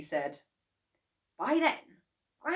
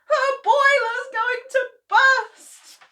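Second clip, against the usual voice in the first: high, slow crying wail of a panicking wife; 14 dB louder, slower than usual. Acted. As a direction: sob it out in a high, slow, tearful voice. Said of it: "Missus Cranky wailed".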